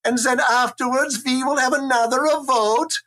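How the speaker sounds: put-on a Viking proposing democracy in a high, sing-song Scandinavian accent; mock Scandinavian accent. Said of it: "It sounds German".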